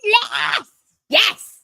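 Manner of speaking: high, loud triumphant yes; real delight at the result; jubilant cheer. Real, not acted. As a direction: celebrate the three points out loud. Co-host celebrating the win.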